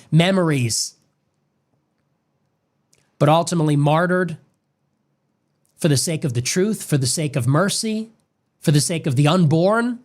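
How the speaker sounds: usual speaking voice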